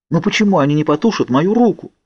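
high-pitched, anguished question; distressed. A narrator's reading. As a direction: ask desperately, in pain. Narrator in burning agony.

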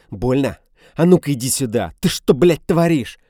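man losing control, shouting in rage; enraged yell. A narrator's reading. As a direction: roar at her. Man enraged, yelling at Dina.

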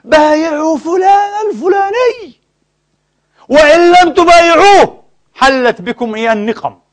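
high, slow, pompous mock preacher voice; mock demagogue cleric. Lecturer mimics a cleric threatening those who refuse allegiance, high and pompous. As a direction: ham up the cleric demanding allegiance. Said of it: "أن يقول".

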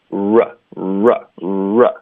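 R as separate slow "ruh" sounds, tongue curled, lips pouted. Shown as a demonstration. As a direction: pout and curl each r. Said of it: "要卷舌". (卷舌嘟嘴读 r)

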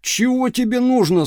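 high stern bark at the door; stern shout. A narrator's reading. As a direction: challenge the stranger sternly. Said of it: "сурово закричал". (суровый окрик)

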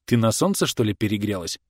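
plain reading voice

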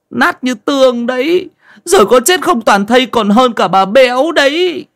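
high-pitched whimpering wail; loud, whiny, blubbering entreaty; tearful pleading. A narrator's reading. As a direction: beg desperately, close to tears. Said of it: "Nó mếu máo, nài nỉ".

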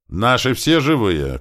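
high, loud drawn-out call; shouting. Acted. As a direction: call slowly across a distance. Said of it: "крикнул Туман".

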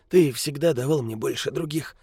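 put-on high, croaking old beggar; grumpy old man. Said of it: "закряхтел старик".

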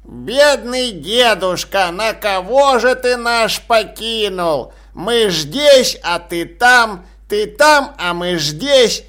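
slow whiny parody of wailing; mocking old man. A narrator's reading. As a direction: mimic the whining relatives. Old man mocks mourners' wailing.

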